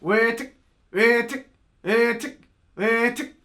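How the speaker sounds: one hen note at a time, rising; single bouncing hen note. Shown as a demonstration. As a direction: separate quacking notes, each ending in T. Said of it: "just gonna wanna try and do one".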